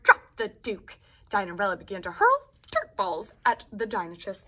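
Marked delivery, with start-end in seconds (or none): 0.0-1.0 loud commanding roar. Acted. Dinarella roars at the dinochus from the dune, loud. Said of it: "she roared".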